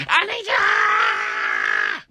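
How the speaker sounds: desperate shriek into a long wail; screaming. Acted. Kid begging mom for rescue, hysterical screaming. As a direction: scream it out in total panic. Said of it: "Stop screaming".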